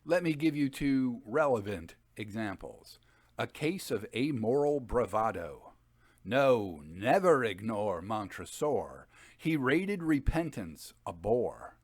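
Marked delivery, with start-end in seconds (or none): 3.4-11.8 louder, bouncy verse recital; a louder, sing-song poem read; limerick reading. Shown as a demonstration. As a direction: recite it with a playful rhythm. Reads a limerick.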